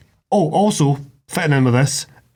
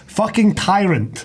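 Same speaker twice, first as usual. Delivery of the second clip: usual speaking voice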